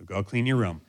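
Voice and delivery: ordinary delivery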